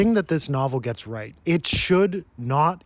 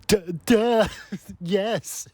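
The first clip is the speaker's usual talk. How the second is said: put-on high-pitched dim-witted girl; hammed-up dopey stammer up high; dopey Bella; 7 semitones higher, much slower than usual. Clumsy girl answering Edward, comically dopey. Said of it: "And she's like".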